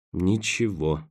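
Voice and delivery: a low, disheartened half-whisper; dejected whisper. A narrator's reading. Roen after a long, fruitless wait, dejected and hushed. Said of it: "удрученно прошептал Роэн".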